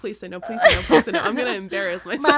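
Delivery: mock pleading. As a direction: beg to be refused, half laughing. Acting out inner begging to be refused.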